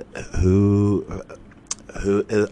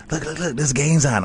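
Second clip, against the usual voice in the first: excited; 8 semitones higher than usual. Retold or imitated. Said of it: "and I was like".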